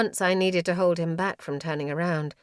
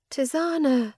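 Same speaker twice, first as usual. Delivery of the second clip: calling mother; 8 semitones higher, 4 dB louder than usual. A narrator's reading. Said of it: "she called to me".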